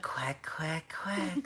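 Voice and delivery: high, whispery little quacks; whispered duck quacks. Pretend. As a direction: quack softly like ducks in pursuit. Mimicking ducks chasing the kids.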